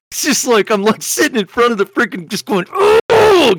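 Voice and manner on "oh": a sharp, high, loud "Oh" of frustration; angry oh. Retold or imitated. Fed up with the app in the stall, a loud angry oh. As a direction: burst out in annoyance. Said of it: "getting angry".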